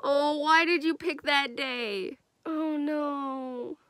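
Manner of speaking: soft, sorrowful dismay; a quiet lament, with drawn-out sighing words; real sad. Real, not acted. Viewer reacting to the chosen date, softly sad. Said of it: "I'm about to get real sad".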